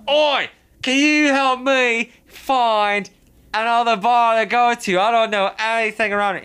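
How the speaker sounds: high, loud mock-Australian holler; imitated Australian accent. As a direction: play a drunken Australian calling out for help. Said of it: "I just got suckered by the accent".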